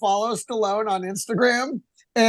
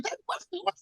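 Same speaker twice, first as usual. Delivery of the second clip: quiet, baffled little voice, slowing down; puzzled muttering at strange sound effects; mock confused; 8 semitones higher, 14 dB quieter than usual. Confused about the punch sounds, quietly baffled.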